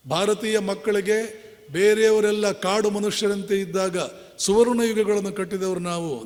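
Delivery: ordinary talk, nothing marked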